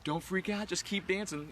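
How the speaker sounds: high-pitched quoted friend; imitating friend's urging. Amy urging a panicking friend to dance, imitated high voice. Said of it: "and she's like".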